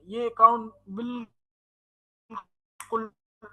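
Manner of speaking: glitchy, distorted internet audio; distorted bad connection. Real, not acted. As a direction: voice cutting out over a bad line. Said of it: "distortion आ रही है आपकी आवाज में".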